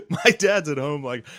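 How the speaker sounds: ordinary talk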